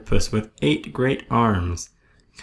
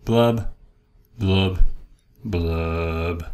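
each blub sinks lower, last one long and deep; glum blubbing; 5 semitones lower, much slower than usual. Acted. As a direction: bubble it out, sinking into gloom. Fish's sinking refrain.

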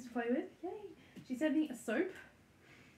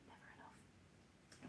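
slow, much softer whisper; whispered aside; 23 dB quieter, much slower than usual. Real, not acted. Vlogger whispers a joking aside to viewers, slow. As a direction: breathe it as a secret aside.

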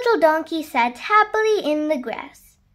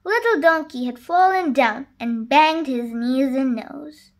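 ordinary delivery

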